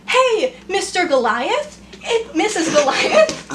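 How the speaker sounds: high, slow mock-cheerful hello; drawn-out bitter pleasantry; slow sarcastic greeting.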